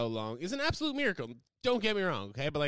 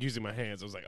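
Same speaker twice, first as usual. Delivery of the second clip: ordinary talk, nothing marked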